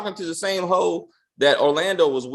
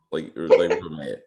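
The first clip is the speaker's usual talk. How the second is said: normal talking voice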